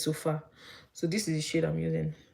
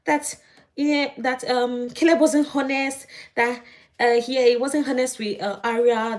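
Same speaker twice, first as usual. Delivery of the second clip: mocking imitation; 7 semitones higher, 5 dB louder, slower than usual. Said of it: "open her mouth that".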